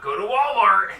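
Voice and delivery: loud mock ad shout, pitched high; shouted slogan. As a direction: yell it like a cheesy store ad.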